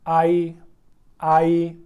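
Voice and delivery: आई said slow and clear as Chinese ai; ai sound demo. Shown as a demonstration. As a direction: model आई cleanly, mouth open on the a. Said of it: "इसको हम कैसे पढ़ेंगे?". (आई को साफ़ और धीरे बोलकर दिखाना)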